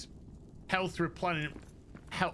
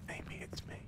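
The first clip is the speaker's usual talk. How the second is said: whisper; 13 dB quieter than usual. Pretend. Whispered self-answering bit.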